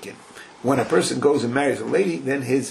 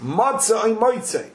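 high, lively mock question; a hint of a nosy neighbour asking; nosy greeting; 8 semitones higher than usual. Neighbour quizzing the groom, lightly mocking. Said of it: "they would say to him".

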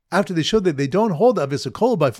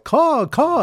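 much higher, loud amazed caw; astonished caw; 11 semitones higher, 4 dB louder than usual. Retold or imitated. Bird watchers astonished at the hovering birds, high amazed caw. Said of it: "in astonishment".

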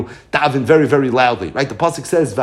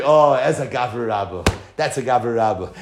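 hushed admiration; 6 semitones lower than usual. Real, not acted. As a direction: drop low in deep admiration. Marvels at the scholar's greatness.

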